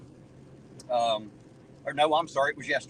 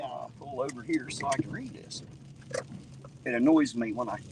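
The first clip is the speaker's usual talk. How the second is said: ordinary delivery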